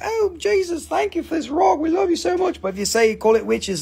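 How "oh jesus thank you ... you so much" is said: high, gushing mock prayer. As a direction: ham up a sugary thank-you to Jesus. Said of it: "be like".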